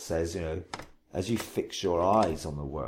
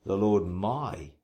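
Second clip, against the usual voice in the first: slow phrase landing on a drawn-out "my"; stress on MY; slower than usual. Shown as a demonstration. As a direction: stretch out "my" as the word to ponder. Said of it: "then I would emphasize each word".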